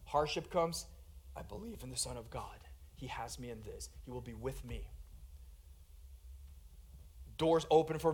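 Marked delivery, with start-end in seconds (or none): 1.3-4.9 very low, hushed affirmation; soft, low, private declaration; quiet trust. Acted. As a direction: murmur it as a steadying confession of faith. Paul's quiet trust in hardship, acted.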